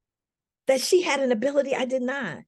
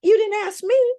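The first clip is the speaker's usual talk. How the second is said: friend firmly objecting; 10 semitones higher, 3 dB louder than usual. Retold or imitated. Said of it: "She goes".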